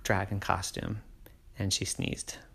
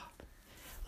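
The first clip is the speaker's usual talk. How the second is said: quiet breathy sigh; soft sigh; 27 dB quieter than usual. A narrator's reading. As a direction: let out a patient sigh. Teacher sighs.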